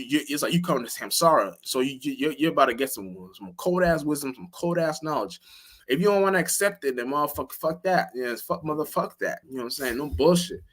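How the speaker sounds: ordinary talk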